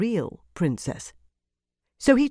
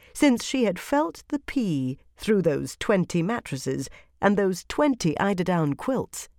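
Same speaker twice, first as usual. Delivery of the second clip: plain reading voice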